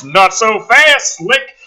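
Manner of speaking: slow, loud taunt at the hero; loud mock threat. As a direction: bark it from off-screen. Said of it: "off-screen voice".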